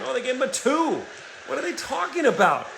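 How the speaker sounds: high, disbelieving outburst at the refs; sharp rise of incredulous protest; indignant surprise. Real, not acted. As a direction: react like you can't believe the call. Disputing the referees' call.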